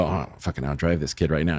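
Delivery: under his breath, sure he'll win; quiet mock bravado before the swing; muttered inner voice. Cocky thought before golf swing.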